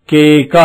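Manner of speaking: low, careful కేక; teacher modelling కేక; model reading. Shown as a demonstration. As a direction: say it clearly for the class. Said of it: "ఏమిటది".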